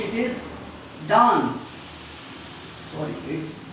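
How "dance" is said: DANCE with long open "ah"; British dance. Shown as a demonstration. Teacher modelling British English, DANCE with broad "ah". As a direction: say DANCE as "daans". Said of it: "In British English".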